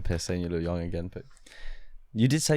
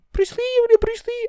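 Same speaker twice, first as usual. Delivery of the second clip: very high, loud Scottish caricature; squawked Scottish question, hammed up; thick Scottish uncle; 6 dB louder than usual. Uncle asking nephew about Bruce Lee, thick Scottish accent. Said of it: "That is an accent".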